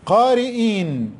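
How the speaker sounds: قارئين drawn out slowly; slow dictation. Shown as a demonstration. Teacher to pupils, slowly stretching قارئين. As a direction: sound out قارئين unhurriedly. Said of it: "teacher dictating word slowly".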